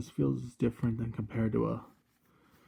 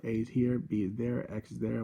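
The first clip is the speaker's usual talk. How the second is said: ordinary talk, nothing marked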